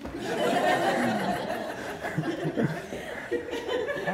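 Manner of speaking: high airy burst of laughter; real amused laugh; high breathy laugh. Real, not acted. Laughing at the dude story.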